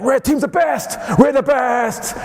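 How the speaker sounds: high, shouted team chant; mock team cheering. Lecturer mimicking paintballers boasting for their new team, mock cheering. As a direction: cheer like an instant die-hard fan.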